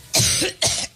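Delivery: sharp hacking cough; chesty cough. Real, not acted. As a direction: cough mid-speech, then apologize. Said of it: "excuse me".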